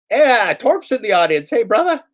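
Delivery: high, loud, bright hello to a viewer; excited greeting. Real, not acted. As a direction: call out happily to Torp.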